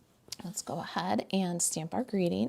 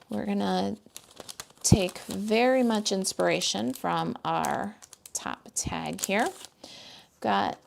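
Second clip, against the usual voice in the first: ordinary delivery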